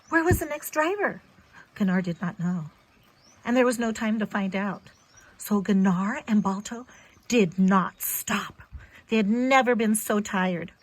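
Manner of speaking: ordinary delivery, nothing marked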